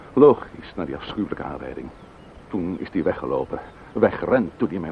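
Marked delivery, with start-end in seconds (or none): 0.1-0.7 sharp, loud and higher; furious retort; angry outburst. Acted. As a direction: bite the word off in fury. Widower snaps at the neighbour.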